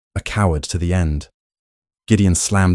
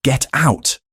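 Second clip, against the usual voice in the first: bellowing; 7 semitones higher than usual. A narrator's reading. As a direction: throw him out with a roar. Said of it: "Gideon bellowed".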